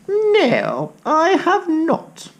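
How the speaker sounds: put-on high, startled old lady reply. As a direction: squeak it out like a little old lady. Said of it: "said the old lady".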